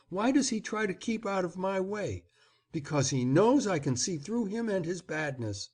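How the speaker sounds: lightly voiced child, insistent and accusing; a boy warning his mother about the baron; defiant boy. A narrator's reading.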